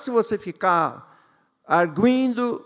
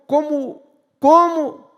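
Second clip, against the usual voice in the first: emphatic cry; 7 semitones higher, 7 dB louder, slower than usual. Acted. Preacher building emphasis.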